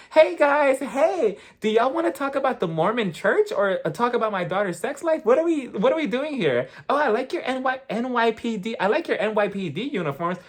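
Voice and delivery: high-pitched, chirpy imitation of a bold busybody; bold Heather. Recapper mimicking a housewife barging up to police, bold and high. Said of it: "Heather was so bold".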